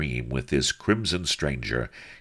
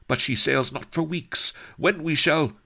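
higher, rushing words; eager blurt; 14 semitones higher than usual. Acted. Sheba lets slip the plot, higher eager voice. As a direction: let it slip too eagerly.

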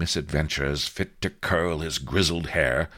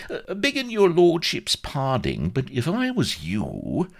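frosty; 11 semitones higher than usual. Acted. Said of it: "interposed Vladimir frostily".

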